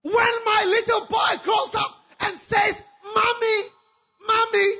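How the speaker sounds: loud, high, wailing despair; anguished crying shout. Acted. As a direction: cry it out, heartbroken and loud. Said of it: "She cried".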